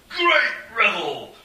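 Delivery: booming, drawn-out high roar; old lord roaring his delight over the din; old lord's roar. A narrator's reading. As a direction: roar it out across a noisy feast hall. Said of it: "heard one old lord roar".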